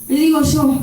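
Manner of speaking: ordinary talk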